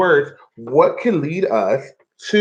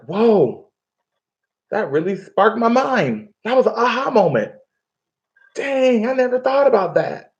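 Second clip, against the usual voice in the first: higher, excited inner voice; amazed reader; 8 semitones higher than usual. Retold or imitated. Teacher acting out a student's aha moment, excited. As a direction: play a student struck by a new idea. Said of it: "you're like".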